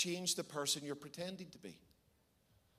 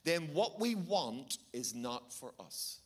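slow, weighty preaching; solemn emphatic slow sermon; slow and deliberate; 4 semitones lower, slower than usual. Real, not acted. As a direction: let each word land slowly. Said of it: "I want you to hear this truth very powerfully".

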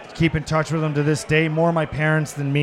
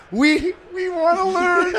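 laughing mock plea; 13 semitones higher, slower than usual. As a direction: beg to learn while cracking up, much higher. Mock eager plea to coach.

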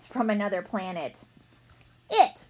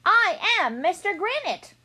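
insistent alien; 9 semitones higher, 5 dB louder than usual. Acted. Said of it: "repeated the alien".

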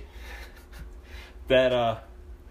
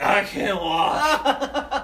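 high, very drawn-out complaint; mock-pitiful slow whine; helpless drunk wail; 8 semitones higher, 5 dB louder, much slower than usual. Drunk self wails to driver.

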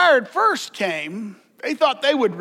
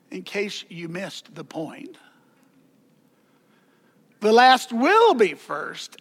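usual speaking voice

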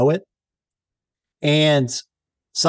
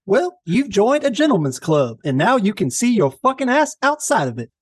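higher, slow genteel Southern drawl; hammed-up Southern gentleman cursing; 5 semitones higher than usual. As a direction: insult someone with gracious Southern charm. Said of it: "with such a soothing drawl".

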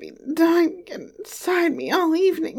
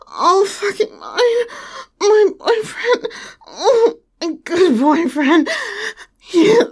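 much higher, much louder crying out; unrestrained climax cries; 9 semitones higher, 11 dB louder than usual. Acted. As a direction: peak and break into cries. Climax, high loud cries.

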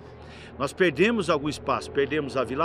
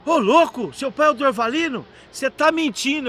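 high-pitched incredulous retort; mock disbelief; 7 semitones higher, 3 dB louder than usual. Police chief doubting the mayor's son, incredulous. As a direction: act out astonished doubt, pitched up.

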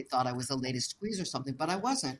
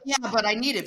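louder, higher retold insistence; insisting to the bank clerk, raised voice; insistent; 6 semitones higher, 7 dB louder, faster than usual. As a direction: press the clerk. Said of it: "so then I'm like".

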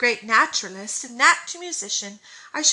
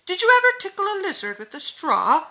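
eager, higher and louder question; eager boy; 9 semitones higher, 4 dB louder than usual. A narrator's reading. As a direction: ask it bursting with eagerness. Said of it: "asked Dan eagerly".

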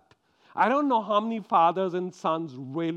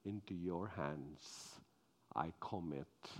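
hushed sermon; 8 semitones lower, 6 dB quieter than usual. Acted. Preacher closing the Abraham story in a hushed voice. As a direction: draw them in with a low, quiet telling. Quiet reflective sermon close.

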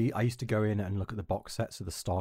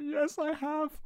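much higher, small put-on voice; timid high admission; much slower than usual. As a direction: own up meekly to the bully. Meek replies to cornering bully.

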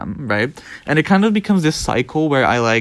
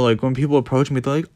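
normal talking voice

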